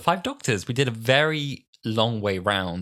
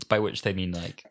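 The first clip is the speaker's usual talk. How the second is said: ordinary delivery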